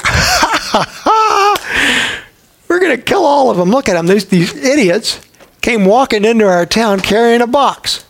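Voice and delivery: high cackling laugh, then jeering; mocking laughter. Jericho townsman jeering at the marching Israelites, mocking laugh. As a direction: laugh at them like a scornful townsman.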